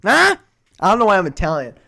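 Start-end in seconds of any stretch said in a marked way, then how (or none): 0.0-0.3 much higher, slow, louder mock outrage; mock outraged relative. The family is appalled at the baby shower, mock outraged. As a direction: play a shocked in-law.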